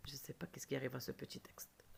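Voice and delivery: very soft fast whispered aside; baffled whisper at a glitching screen; puzzled whisper. Real, not acted. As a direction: murmur to yourself, puzzled. Muttering about screen glitch.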